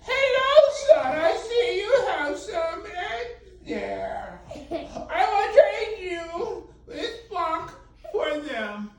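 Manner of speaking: put-on high, slow, laughing old-man voice; a giggly wheezing old trader. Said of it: "this is how he talks".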